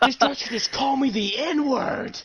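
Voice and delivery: high, loud, drawn-out mock outrage; outraged friend impression. Tripping friend accusing a dresser, loudly outraged. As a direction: cry out in hammed-up indignation.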